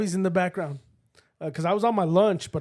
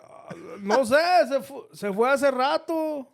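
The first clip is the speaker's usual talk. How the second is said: slow, higher hesitant answer; kid covering up; 6 semitones higher, slower than usual. Retold or imitated. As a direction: imitate a child stalling for dad. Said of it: "I was like".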